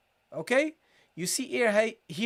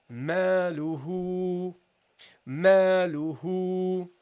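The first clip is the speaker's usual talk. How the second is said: "Maluhu" drawn out slowly, "hu" held long; slow teaching recitation of "Maluhu"; elongated vowels; 2 semitones lower, much slower than usual. Shown as a demonstration. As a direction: stretch "ma" and "hu" to double length. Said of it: "read it twice as long".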